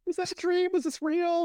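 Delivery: very high, dazed-question impression; Alice. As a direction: put on a tiny high voice for a woman waking up. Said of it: "Do your Alice impression there".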